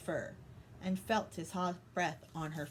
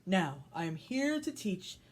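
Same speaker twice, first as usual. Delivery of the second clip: tiger voice; 3 semitones lower than usual. Acted. Tiger speaks in story.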